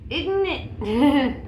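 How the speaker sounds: half-laughing rhetorical question. Real, not acted. Laughs at own grim point.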